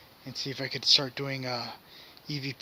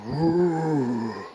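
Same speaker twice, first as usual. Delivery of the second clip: monster roar imitation; 5 dB louder than usual. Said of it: "Thing sounds like a freaking monster".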